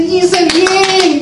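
very high, gleeful child imitation; cheering little boy. Little son put down on the floor, high gleeful mimicry. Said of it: "el niño dice".

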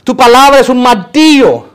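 high, loud preaching cry; preaching declamation. Real, not acted. Preacher building a list about God's word, loud declamation. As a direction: proclaim it at full force.

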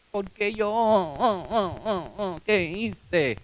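soft, sobbing mock-baby whimper; hammed-up baby crying, trailing away; whiny baby. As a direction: blubber it quietly like a sulking baby. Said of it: "como un baby".

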